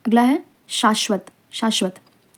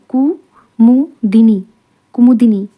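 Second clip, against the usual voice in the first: कुमुदिनी very slowly, split as कु, मु, दिनी; syllable by syllable; 8 dB louder, slower than usual. Shown as a demonstration. Teacher dictating a spelling word, slow syllable by syllable.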